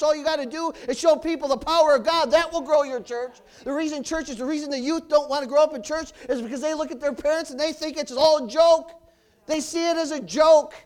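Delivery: loud, fervent pulpit preaching; preaching at full force. Acted. Preacher rousing his congregation, preaching at full force. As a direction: preach it out to the whole church, full voice.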